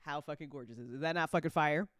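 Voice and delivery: ordinary delivery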